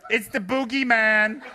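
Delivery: slow, loud teasing reply; mock spooky answer. Rinpoche jokes at his locked door, slow and teasing. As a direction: answer like a joking boogeyman.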